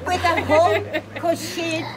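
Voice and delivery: high, loud laughing telling; giggling through the words. Real, not acted. Widow telling friends he is now in a basket, laughing high.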